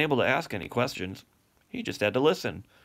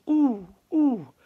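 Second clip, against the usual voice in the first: much higher hooting owl call; 14 semitones higher, 3 dB louder than usual. Retold or imitated. Father imitating the little owl's hoot to his cygnets. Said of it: "the little owl that says".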